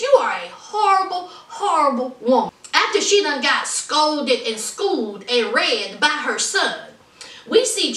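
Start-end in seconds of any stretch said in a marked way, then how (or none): none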